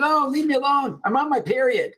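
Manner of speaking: high-pitched imitation of an annoyed woman; mock irritated retort. Retelling pregnant woman brushing off her nice colleague, mock irritated. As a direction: snap it back high, as a hammed-up brush-off.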